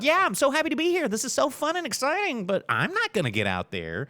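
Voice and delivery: high, hammed-up fake enthusiasm; mock cheerful crowd. Mocking everybody who won't go out first, high fake cheer. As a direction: chirp it out like fake-happy people. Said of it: "everybody's looking like".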